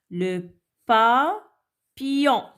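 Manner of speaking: slow Le, pa, pillon with gaps; syllable by syllable. Shown as a demonstration. Teacher cutting words up for children, papillon slowly split. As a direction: break papillon into pa - pillon, falling at the end. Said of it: "découper les mots en syllabes".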